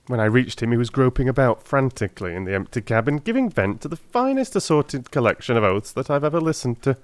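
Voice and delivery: lightly voiced retelling by a cabman; hint of a chatty cabman; story narration voice. Cabman figure retold in story.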